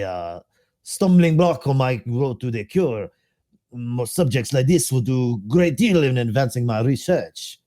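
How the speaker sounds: lightly voiced French doctor accent, slow; slow, faint French accent as the plague doctor; doctor's accent. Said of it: "I'll try to do the accent".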